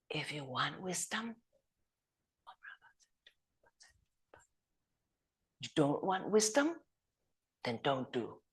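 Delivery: the lama looks up and quietly tells the students; hushed teacher. Retold or imitated. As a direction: let it drop softly, as a gentle dare.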